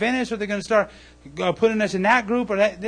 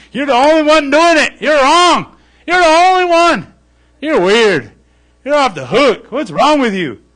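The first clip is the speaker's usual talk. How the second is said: loud, higher mocking shouts with a laugh; jeering crowd; 6 semitones higher, 11 dB louder than usual. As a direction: yell and laugh at the odd one out. Neighbors taunt Noah.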